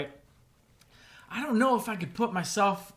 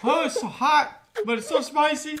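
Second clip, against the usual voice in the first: laughing through spicy burn; 9 semitones higher, 9 dB louder than usual. Real, not acted. Reacting to very spicy candy.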